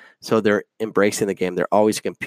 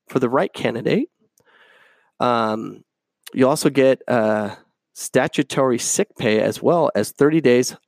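ordinary talk, nothing marked

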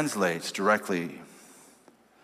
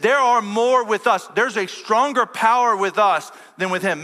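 preaching at full force; 11 semitones higher, 5 dB louder than usual. Acted. Pastor booming Hezekiah's words to his army, preaching at full force. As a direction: thunder it like a preacher rallying troops.

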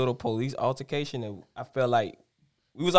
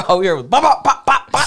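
imitated gunshots; 9 semitones higher, 7 dB louder than usual. Imitating gunshots heard round corner.

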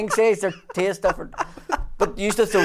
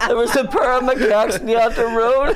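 laughing through it; 6 semitones higher, 6 dB louder than usual. Retold or imitated.

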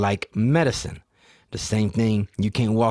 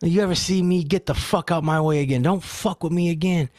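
retold angry telling-off, pitched up; mock furious warning, higher voice; cop yelling; 6 semitones higher than usual. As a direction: imitate an irate cop without much extra volume. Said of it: "the cop yelled at him and told him".

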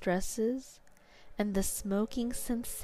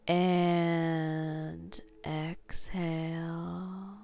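sleep story narrator; slower than usual. Acted. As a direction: guide them down into sleep, slowly. Guided hypnosis induction.

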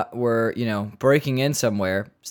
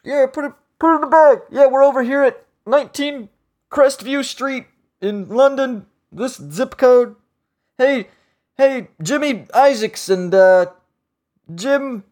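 much higher, loud, hammed-up British burglar voice; mock British burglar; 12 semitones higher, 5 dB louder than usual. Host imitating burglars heard over a pocket dial, hammed-up British. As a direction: babble like a careless British crook on the phone. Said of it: "all you hear is like".

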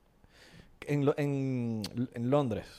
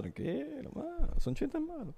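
high mock reassurance; 13 semitones higher, 8 dB quieter, slower than usual. Reassuring nervous friend.

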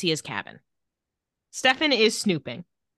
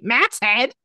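mock line reading of Matt's head, pitched up; imitating actor's slipping accent; 4 semitones higher than usual. Podcaster mimicking actor's bad delivery of Matt's head, imitating slipped accent. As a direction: mimic his failed dialect with a questioning lift. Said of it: "The way he says Matt's head is very".

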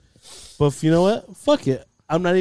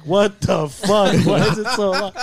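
laughing disbelief; 4 semitones higher than usual. Retold or imitated. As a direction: retell his outrage while cracking up. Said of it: "He's like".

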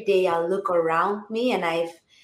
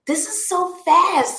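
much higher, louder astonished quote; pitched-up mock astonishment; retold amazement; 12 semitones higher, 6 dB louder than usual. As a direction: imitate a man amazed at the speed. Retells the man's amazement at speed.

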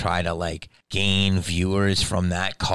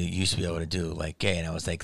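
ordinary talk, nothing marked

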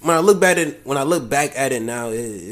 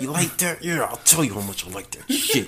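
mock sex talk; 6 dB quieter than usual. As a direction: ham up hushed dirty talk, half laughing. Replays his line as sex talk.

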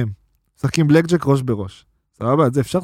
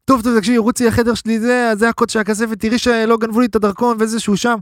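frantic phone call; 7 semitones higher than usual. Retold or imitated.

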